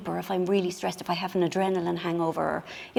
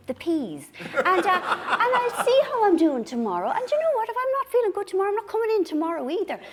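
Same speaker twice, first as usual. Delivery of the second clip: laughing daydream; 7 semitones higher than usual. Retold or imitated. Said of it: "the more I think".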